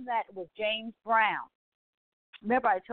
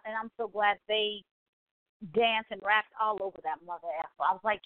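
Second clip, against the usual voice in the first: usual speaking voice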